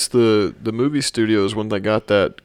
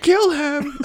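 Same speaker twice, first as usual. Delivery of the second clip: high squealed yell with a laugh; a high laughing mock yell; 4 dB louder than usual. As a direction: screech the order like a cartoon villain. Harry's shout in retold scene.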